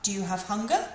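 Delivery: "hunger" going up as a question; rising intonation. Shown as a demonstration. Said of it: "it turns it into".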